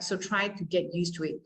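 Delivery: normal talking voice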